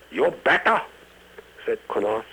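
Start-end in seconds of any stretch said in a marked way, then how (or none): 0.1-0.8 high, loud mock-Chinese threat; ominous warning. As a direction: warn the bouncer, cane raised. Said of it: "shaking his cane ominously".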